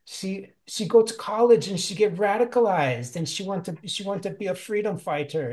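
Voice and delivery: a touch of high mockery; mocking. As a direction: scoff at the rich girl. Jala keeps mocking May.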